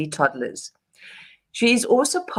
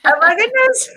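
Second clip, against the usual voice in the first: embarrassed laugh; 4 dB louder than usual. Real, not acted. Laughing at accent remark.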